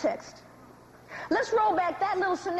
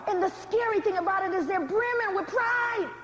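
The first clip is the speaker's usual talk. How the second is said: ordinary talk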